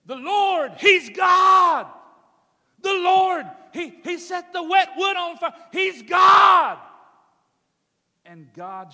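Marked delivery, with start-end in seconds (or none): 0.1-6.8 much higher, full-force shout; shouting praise. Acted. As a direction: cry it out to the heavens. People cry out at Carmel.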